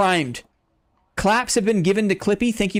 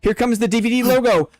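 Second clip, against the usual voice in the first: excited, higher fight commentary, building to the finish; play-by-play; 4 semitones higher than usual. Acted. Streamer calling the bear fight for chat, excited play-by-play. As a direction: call every hit like a ringside announcer.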